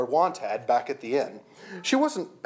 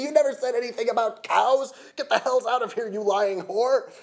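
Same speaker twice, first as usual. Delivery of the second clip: high-pitched furious tirade; screaming rage; 11 semitones higher, 3 dB louder than usual. A narrator's reading. Jeff ordering the cow mage out, screaming. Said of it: "Jeff screamed at me".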